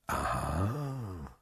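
low, very soft, drawn-out aha; appreciative murmur. A narrator's reading. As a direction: murmur it with quiet approval. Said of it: "murmelte Monte Cristo anerkennend". (anerkennend gemurmelt)

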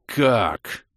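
understated roaring question; mild roar. A narrator's reading. Crusader protesting he can't step aside, understated roar. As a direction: growl out a single indignant word. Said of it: "взревел крестоносец".

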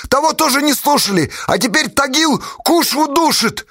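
high, strained warning cry; frantic high-pitched yell; desperate shout. A narrator's reading. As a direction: keep shouting desperately at the departing captain. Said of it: "отчаянно крикнул капитану Асташа".

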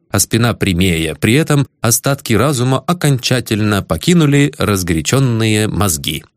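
usual speaking voice

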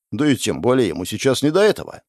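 ordinary delivery

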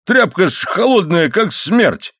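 high-pitched exclaimed complaint; indignant outcry. A narrator's reading. Husband complains of wife's cold flannel, crying out. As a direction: yelp the words, pitched well up.

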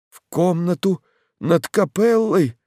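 high, gasping, horrified question; gasping in fear. A narrator's reading. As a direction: gasp it out in terror. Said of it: "задыхаясь, проговорил священник".

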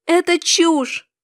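high, stretched-out exclamation; insistent protest. A narrator's reading. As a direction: push back hard, dragging the words. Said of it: "пыталась я её убедить". (возмущённо убеждая)

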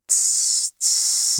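sharp unvoiced hiss for silence; hissing shush. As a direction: hiss a warning shh. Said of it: "зашипел Горлум".

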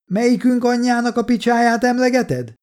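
high, sustained shouted question; calling back. A narrator's reading. Soldier answers the insult, now nearer, calling out. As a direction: demand an answer across the distance.

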